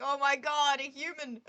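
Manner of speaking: loud, delighted squeal of surprise; thrilled Toriel shriek; excited gasp. Retold or imitated. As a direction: gush over finding a human. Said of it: "She's like".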